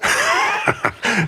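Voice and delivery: high, breathy villain's laugh; sinister airy cackle; evil chuckle. Acted. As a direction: laugh at being called vile. Sorcerer amused by accusation.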